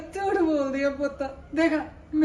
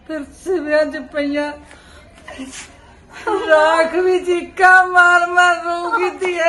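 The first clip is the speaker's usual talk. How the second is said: slow, louder wail that breaks into sobs; mother's slow, sobbing lament, higher and louder; crying out; 5 semitones higher, 6 dB louder than usual. Acted. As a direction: cry the words out as a grieving mother. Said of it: "ਮਾਂ, ਰੋਣਾ ਨਹੀਂ".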